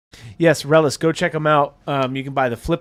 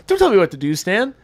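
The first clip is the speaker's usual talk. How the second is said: indignant retort; 8 semitones higher, 5 dB louder, faster than usual. Retold or imitated. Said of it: "[name], you can do a better pirate".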